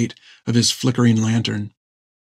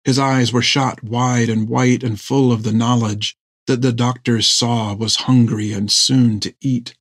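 ordinary delivery, nothing marked

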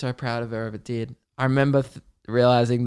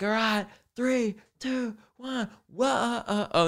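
singer counting in; 9 semitones higher, slower than usual. Retold or imitated. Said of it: "he's like".

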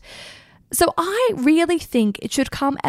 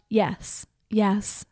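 hushed deadpan yeses, falling away to near whisper; soft, spaced-out mock climax; understated mock scream; 3 semitones lower, 7 dB quieter, much slower than usual. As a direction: quote the screamed yeses softly and low. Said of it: "screaming out".

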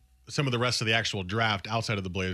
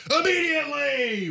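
yelled out; 4 dB louder than usual. Shown as a demonstration. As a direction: read the all-caps word as a sudden yell. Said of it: "that's why I yelled it".

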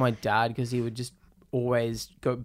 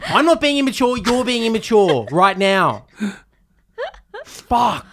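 hammed-up tantrum; 9 semitones higher, 8 dB louder than usual. Teen yelling back at dad, high and loud, hammed up. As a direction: throw a playful teenage fit.

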